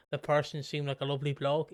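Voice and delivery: usual speaking voice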